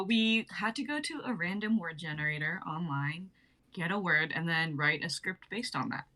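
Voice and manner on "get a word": normal talking voice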